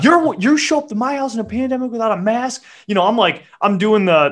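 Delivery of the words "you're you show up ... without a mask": high, outraged accusation; high indignant outburst at the worker. Retold or imitated. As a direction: call her out, high and sharp. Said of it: "I was just like".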